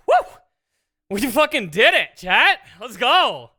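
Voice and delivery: loud, high triumphant cheer; excited cheering. Real, not acted. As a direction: celebrate the win out loud. Celebrating code working.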